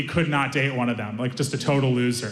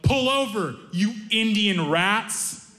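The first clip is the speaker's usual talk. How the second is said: higher, slow drawn-out insult; mock angry yell; 6 semitones higher, much slower than usual. Furious driver yelling from his window, drawn out. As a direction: imitate a driver yelling across to another car. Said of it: "he rolled down his window and he yelled".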